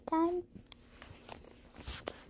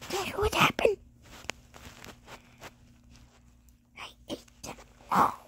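hushed secretive whisper; 5 semitones higher, 4 dB quieter, faster than usual. Pretend.

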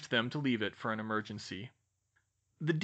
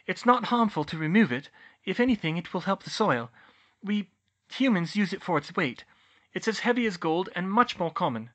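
put-on high, louder young witch; Hermione, earnest explainer; 6 semitones higher, 4 dB louder than usual. Hermione justifies removing tungsten to Bane, earnestly. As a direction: explain reasonably, pitched up.

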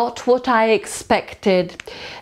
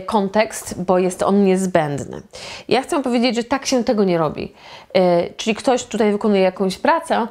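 ordinary talk

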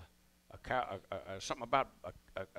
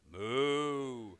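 cow moo. Shown as a demonstration. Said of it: "signal for cattle mooing".

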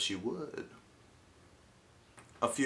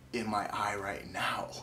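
soft secret whisper; 2 semitones lower, 6 dB quieter than usual. Real, not acted. Unvoiced whisper.

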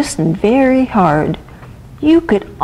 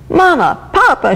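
high-pitched frightened call for parents; crying out; 8 semitones higher than usual. A narrator's reading. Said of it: "she cried".

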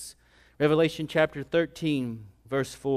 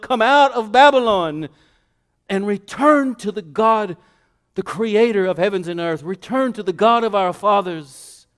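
loud, high preacher's summons; crying out; 6 semitones higher, 8 dB louder than usual. Acted. Law and gospel summon the world to return, crying out. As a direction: hammer each call out to the whole world. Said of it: "will once again cry out to the world".

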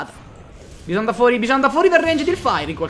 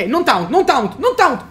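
rising, louder urgent warning; urgent shout; 6 semitones higher, 3 dB louder, slower than usual. Real, not acted. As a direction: yell the warning, building up. Urgent warning during play.

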